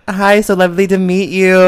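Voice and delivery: overly polite. Retold or imitated. Own polite greeting retold.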